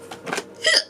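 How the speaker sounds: sharp, high intake of breath; gasp. Real, not acted. Reader noticing the eights on the cards, gasping. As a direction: gasp at what you just spotted. Reacts to the card seen.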